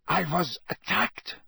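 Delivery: put-on accented Krum. Krum telling Karkaroff of the attack, accented voice. Krum's voice in the story.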